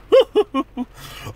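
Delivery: shrill, drawn-out giggle, high up; high hooting laugh. Retold or imitated. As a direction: laugh like Ed Wynn in the film. Ed Wynn laugh imitation.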